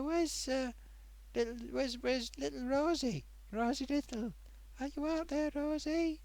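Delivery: high, singsong searching voice; playful calling. Pretend. Streamer seeking a missing regular viewer, high playful calling. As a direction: call softly for someone missing, pitched right up.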